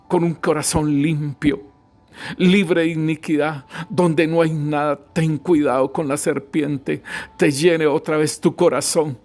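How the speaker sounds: normal talking voice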